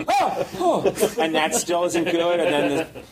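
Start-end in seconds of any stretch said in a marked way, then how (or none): 0.1-1.2 high startled yelps, hammed up. Professor startled by the tiny rubber skeleton, high mock yelps. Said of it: "he gets startled each time".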